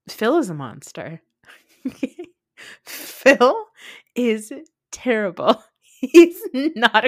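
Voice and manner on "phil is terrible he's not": laughing. Real, not acted. Laughing about villain cast member.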